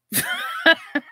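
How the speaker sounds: high bright laugh bursting out; burst of laughter. Real, not acted. Laughing at John's comment about bad choices.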